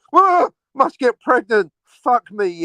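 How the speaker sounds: high, loud, drawn-out mock urgency; mock frantic urgency. Imitating a casual girlfriend whose biological clock ticks, mock frantic. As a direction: burst out as a caricature of sudden desperation.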